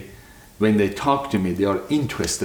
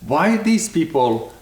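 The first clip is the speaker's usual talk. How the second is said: higher, slowing, baffled quote; retold bewilderment; 7 semitones higher than usual. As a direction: recount being baffled, pitched up. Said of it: "And I was like".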